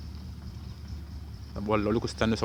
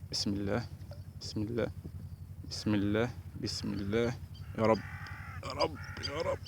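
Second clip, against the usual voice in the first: soft bismillah under the breath; murmured prayer; 10 dB quieter than usual. Real, not acted. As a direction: repeat it softly, hoping for luck. Praying softly while casting. (دعاء بصوت واطي)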